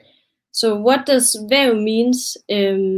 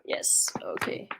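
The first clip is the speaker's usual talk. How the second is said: whisper; 13 dB quieter than usual. Real, not acted. Muted mic aside while fixing laptop.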